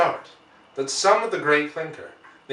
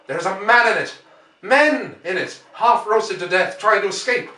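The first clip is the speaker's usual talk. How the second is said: alarmed outcry; 6 semitones higher, 6 dB louder than usual. Acted. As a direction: call it out in dread. Ogilvy realises men are inside.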